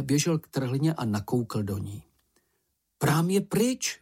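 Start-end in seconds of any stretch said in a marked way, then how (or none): 3.0-4.0 high, drawn-out cry of dismay; mild narrated exclamation, pitched well up. A narrator's reading. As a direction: call it out high and slow. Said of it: "vykřikl".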